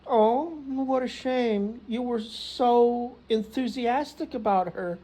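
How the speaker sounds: high, slow mock sympathy; consoling mom. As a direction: draw out the motherly pity in a high voice. Mother consoling son over breakup.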